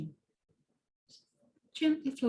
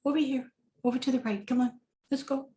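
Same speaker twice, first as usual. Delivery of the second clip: quick, lightly raised, bossy little critter voice; a tiny chipmunk hurrying you along; 4 semitones higher, faster than usual. Pretend. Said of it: "And it says".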